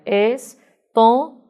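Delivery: "estômago" split slowly into es, tô, ma, go; syllable by syllable. Shown as a demonstration. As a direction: clear pauses inside "estômago". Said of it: "Você dividindo, você teria". ("estômago" dividido em sílabas, devagar)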